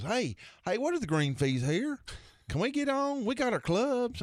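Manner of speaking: very high, eager clueless voice; Bobby's put-on innocence. Buddy begging to play the course, high put-on eagerness. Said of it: "he was just putting it on for this guy".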